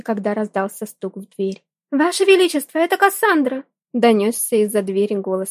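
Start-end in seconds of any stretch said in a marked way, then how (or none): 1.9-3.6 put-on high, loud young woman calling; lady-in-waiting calling. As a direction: call out through the door. Maid calling through door.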